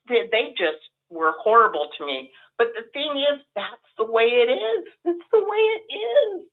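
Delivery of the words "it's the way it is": high, crying through the words; voice breaking into tears. Real, not acted. Telling a friend how the congregation treated her, crying. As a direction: let the tears break the line.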